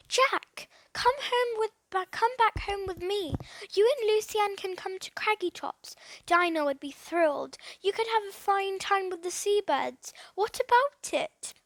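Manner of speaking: bursting with a sudden idea; excited. Acted. Boy invites his friends to Craggy Tops, excited. Philip's brainwave, eager invitation.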